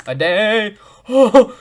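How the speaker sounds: sudden high, loud gasp of fright; startled gasp. Real, not acted. Player startled by the game, gasping high and loud. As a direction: jump and gasp mid-word.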